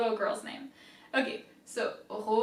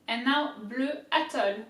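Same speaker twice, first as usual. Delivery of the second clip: ordinary talk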